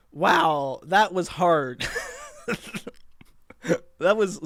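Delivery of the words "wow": normal talking voice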